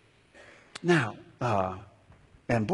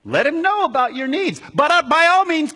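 higher, louder pleading aside; urgent paraphrase of Paul; 11 semitones higher, 8 dB louder than usual. Retold or imitated. Preacher voicing Paul's advice to the church, loud and high. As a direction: urge them on with raised voice. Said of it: "So it's like he's saying".

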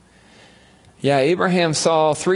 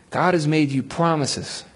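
ordinary delivery

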